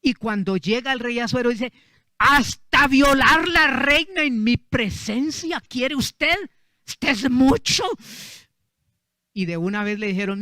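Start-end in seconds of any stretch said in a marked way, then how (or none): 2.2-7.8 loud, high, slow indignant roar; a king exploding in rage at his minister; outraged king. Retold or imitated. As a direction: thunder at the traitor as the furious king. King finds Amán with queen.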